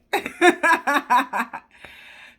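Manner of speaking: loud burst of laughter; loud, high giggle; real laugh. Real, not acted. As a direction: laugh out, caught off guard. Laughs at her own joke.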